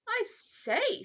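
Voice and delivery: high, loud astonished exclamation. Acted. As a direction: burst out in boyish amazement. Boy exclaims at news of prison.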